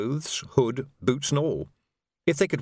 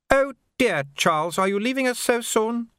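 high, coaxing society lady; charming the lord, high and sweet; 12 semitones higher than usual. A narrator's reading. Madam's voice in story.

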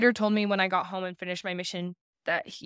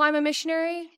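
"I'm a missionary" said much higher with swagger; cocky; 9 semitones higher than usual. Retold or imitated. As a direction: brag "I'm a missionary" like you're invincible. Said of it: "shifting my perspective of, "Oh, I'm invincible".

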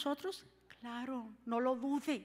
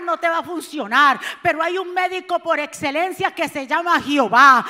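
preaching at full force; 11 dB louder than usual. Acted. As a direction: preach it, building to a shout.

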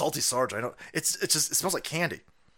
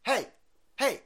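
put-on high, loud shouting old man; hammed-up yelling to prove he's fit; mock Biden; 7 semitones higher, 7 dB louder than usual. Biden yelling at his critics to watch him, hammed-up and high. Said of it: "He go".

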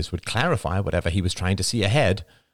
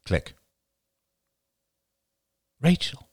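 plain reading voice

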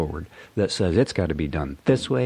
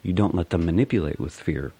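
normal talking voice

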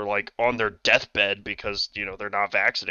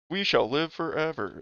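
robot voice; 6 semitones higher, 4 dB quieter than usual. Pretend. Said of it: "in a robot voice".

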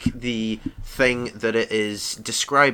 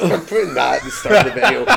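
laughing; 6 semitones higher, 5 dB louder, faster than usual. Real, not acted. Laughing announcement.

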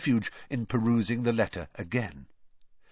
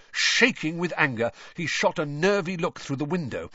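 ordinary delivery

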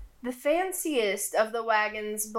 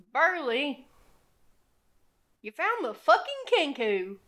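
gruff, higher, quick clown voice; grumpy clown; 5 semitones higher than usual. Acted. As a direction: voice the sulky pipe-smoking clown.

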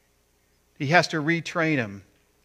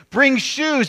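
loud, high calling out; jubilant command; 12 semitones higher, 8 dB louder than usual. Acted. Father orders gifts for returned son, loud and jubilant. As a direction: call out orders with rising excitement.

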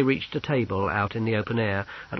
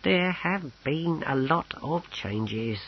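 slow, higher-pitched paper shuffler; officious clerk; 5 semitones higher, 4 dB quieter, slower than usual. Acted. Clerk breaks news of changes to soldiers, officiously.

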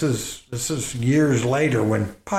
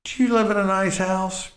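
lecturing dad; 11 semitones higher than usual. Retold or imitated. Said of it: "I go".

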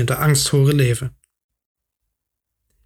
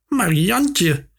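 higher, plaintive protest; wailing aunt; 4 semitones higher than usual. A narrator's reading. Aunt protesting to her nephew, wailing in worry. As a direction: wail the name pleadingly. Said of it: "jammerde de tante".

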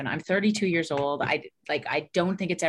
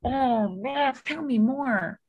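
mildly eager interest; 5 semitones higher, much slower than usual. Retold or imitated. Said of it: "It is this place of".

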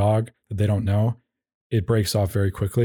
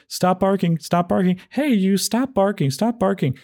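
much higher, sing-song plea to a barking dog; mock the owner's uplifting plea, pitched way up; encouraging pleading tone; 9 semitones higher than usual. Owner pleading with barking dog, mock sing-song high. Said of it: "that kind of tone, that sort of encouraging, you know, rising tone".

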